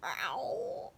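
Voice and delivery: a small high yelp of a growl; growl, but it comes out a squeak; tiny growl. Acted. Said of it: "Hugo growled".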